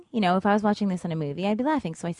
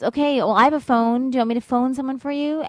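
raised voice calling up from the alley; yelling up; 6 semitones higher, 4 dB louder than usual. Retold or imitated. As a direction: offer help loudly across the distance. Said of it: "He yells up to me".

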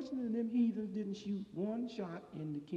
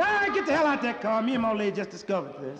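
mock yell; 5 semitones higher, 9 dB louder than usual. Confronting car thief, yelling.

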